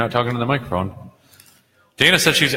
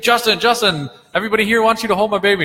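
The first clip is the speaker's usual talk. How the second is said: high, rushed mock pleading; eager fan voice, pitched way up and hurried; pestering fan; 10 semitones higher, faster than usual. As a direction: imitate a fan calling out to a celebrity. Retells fan calling a celebrity.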